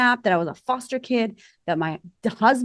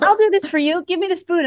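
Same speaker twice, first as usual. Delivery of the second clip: much higher, louder, bubbling with laughter; laughing, higher quote to her husband over coffee; playful high laughing offer; 11 semitones higher, 5 dB louder than usual. Retold or imitated. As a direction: chirp it like a keen helper. Playful kitchen scene with husband.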